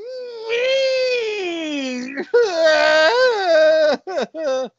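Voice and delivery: long high cartoon bawl; hammed-up crying wail; mock wailing. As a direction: bawl on one held high note. Cop cries at insult.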